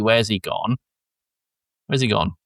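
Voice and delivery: ordinary delivery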